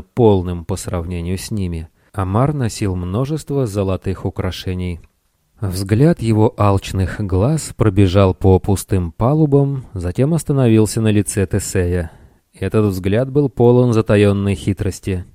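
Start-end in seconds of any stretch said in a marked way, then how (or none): none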